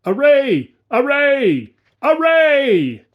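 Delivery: high ringing battle cry, each one falling; thunderous shout. A narrator's reading. A great voice calling the host to arms, thunderous shout. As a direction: bellow it like thunder across the field. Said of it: "a shout louder than a thunder peal, crying".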